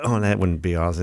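normal talking voice

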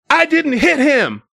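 imitating a panicky woman, high-pitched; mock her defensive babbling; frantic driver quote. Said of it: "losing it, losing her shit".

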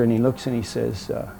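usual speaking voice

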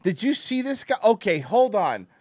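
ordinary talk

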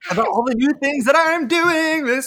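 high, loud, thrilled; excited high voice. Real, not acted. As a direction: keep squealing with glee. Continues excited squeal.

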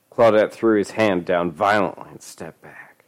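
ordinary delivery

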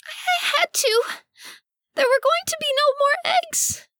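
very high, squeaky creature voice near tears; tearful duck lady. Acted. Cecily explaining herself to the party in tears, very high and squeaky. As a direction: squeak it out high, choked with longing.

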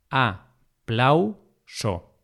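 aplauso drawn apart very slowly, a, plau, so; long pauses between the syllables of "aplauso". Shown as a demonstration. Teacher repeating aplauso's division for learners, syllable by syllable.

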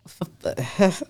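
nervous stammer; stutter. Real, not acted. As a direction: trip over the first sound. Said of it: "why am I stuttering".

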